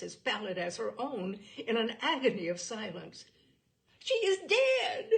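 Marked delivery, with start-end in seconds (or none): 4.0-5.1 shrill, slow, much higher and louder scream of horror; horrified scream. A narrator's reading. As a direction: shriek it out, slowly, as if she's found a corpse. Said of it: "screamed Mary".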